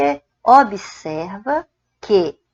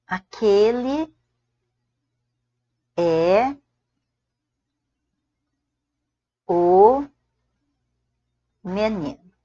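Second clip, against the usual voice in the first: word by word with long pauses; spaced-out dictation pace; slow dictation; much slower than usual. Shown as a demonstration. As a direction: dictate each word slowly, pausing between. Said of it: "você fala assim".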